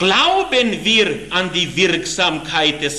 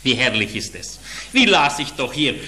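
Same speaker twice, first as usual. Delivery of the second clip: ordinary talk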